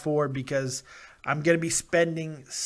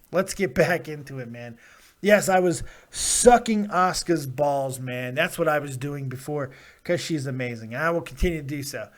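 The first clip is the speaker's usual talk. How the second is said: ordinary delivery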